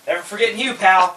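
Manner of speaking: high, loud mock-indignant outburst; emphatic grudge. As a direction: call it out with comic vengeance. Mimics grudge at bad customers.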